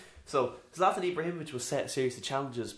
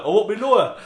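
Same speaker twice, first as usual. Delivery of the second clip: loud, high put-on shout; mock catchphrase voice; 9 semitones higher, 13 dB louder than usual. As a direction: belt it like a catchphrase. Mock catchphrase repeated louder.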